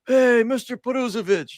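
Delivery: high imitated Chief on the phone. Prank-calling Nikolai as Chief, high put-on voice. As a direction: put on Chief to fool him. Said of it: "I'd get him with Chief".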